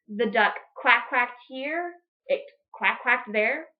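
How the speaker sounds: ordinary delivery, nothing marked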